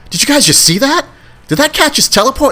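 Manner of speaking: very high-pitched mock disbelief; acted astonishment. Party guests seeing a cat vanish, mock amazement. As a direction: imitate startled guests, voice way up.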